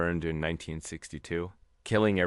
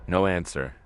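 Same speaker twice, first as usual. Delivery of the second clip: plain reading voice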